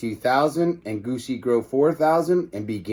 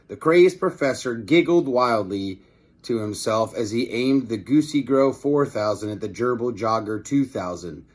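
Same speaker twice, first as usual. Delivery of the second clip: ordinary delivery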